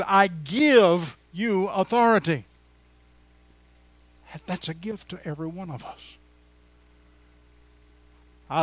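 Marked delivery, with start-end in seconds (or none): 0.0-2.4 Jesus's words, drawn out slowly and loud; slow, loud proclamation of Jesus's words; slow emphatic preaching. Acted. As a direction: declare it like a promise from the pulpit. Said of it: "Jesus said".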